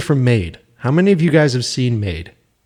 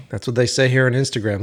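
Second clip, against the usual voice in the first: normal talking voice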